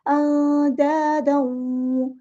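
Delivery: अंदादऊ very slow, the नून hidden and nasal; ikhfa recitation. Shown as a demonstration. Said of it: "इसकी आवाज़ को छुपा देंगे". (नून को छुपाकर धीरे पढ़ना)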